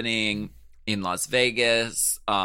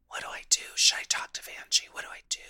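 unvoiced, rushed, frantic whisper; panicked whisper; 6 dB quieter, much faster than usual. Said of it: "Cameron is like".

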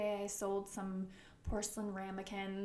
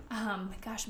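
usual speaking voice

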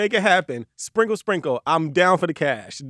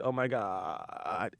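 slow, low, softer creaky oh my God; vocal fry; 7 semitones lower, 12 dB quieter, much slower than usual. Retold or imitated. Host mocking an influencer's daily catchphrase, slow low fry. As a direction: let it creak out lazily. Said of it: "You hit a good".

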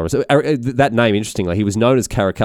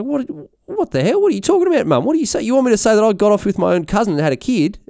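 high-pitched, incredulous mock protest; outraged mum; 12 semitones higher than usual. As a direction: imitate a mum shrieking in disbelief, pitched way up. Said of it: "Elagabalus's mum was like".

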